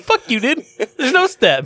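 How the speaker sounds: high-pitched retold protest; mock indignant. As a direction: argue back in a high, incredulous pitch. Retold argument over a step.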